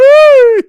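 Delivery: very high, loud shriek of laughter. Real, not acted. Cracking up at Melo's play, high shriek. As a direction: let out a squealing cackle.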